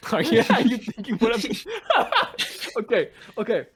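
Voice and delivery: laughing through words. Real, not acted. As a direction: laugh while asking. Laughing at his own idea.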